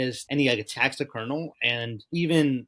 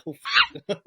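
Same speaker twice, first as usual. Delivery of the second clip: squeaky, much higher gasp of shock; shocked outburst; 4 dB louder than usual. Retold or imitated. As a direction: blurt it out in disbelief. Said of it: "but I was like".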